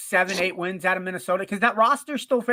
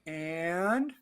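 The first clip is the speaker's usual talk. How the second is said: flat unimpressed; 8 dB quieter than usual. Retold or imitated. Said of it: "And the Dallas Cowboy goes".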